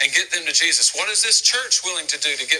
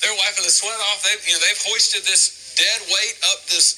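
ordinary talk

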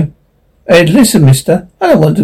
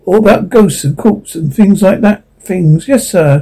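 normal talking voice

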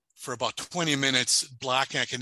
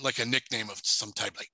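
ordinary delivery

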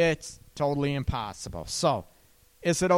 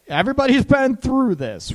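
higher, louder, laughing through it; 11 semitones higher, 8 dB louder than usual. Real, not acted. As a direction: laugh knowingly at the shared experience. Laughs at shared experience.

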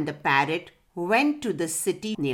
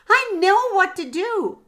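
much higher, louder burst of inspiration; excited exclamation; 12 semitones higher, 6 dB louder than usual. A narrator's reading. Parrot has an idea for pigeon, excited exclamation. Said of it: "the parrot exclaimed".

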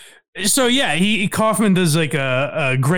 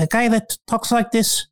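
put-on mild foreign man accent, pitched up; Latka voice; 6 semitones higher than usual. Host describes Kaufman's character, sliding into Latka's mild foreign accent. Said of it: "I apologize for doing a Latka impression".

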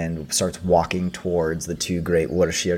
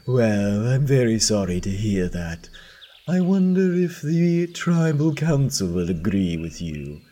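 higher, silky voice of a smug businessman; 4 semitones higher than usual. Acted. The businessman warning the chief about the council, smug. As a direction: make it politely threatening.

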